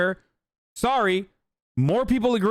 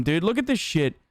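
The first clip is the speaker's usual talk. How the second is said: normal talking voice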